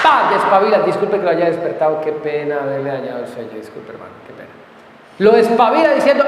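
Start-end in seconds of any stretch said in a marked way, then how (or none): 0.8-4.5 fading into a drowsy hush, faster; sleepy mumbled apology. Acted. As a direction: mumble it like someone half asleep. Sleepy son apologising, dropping away.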